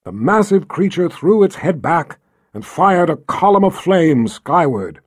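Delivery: ordinary delivery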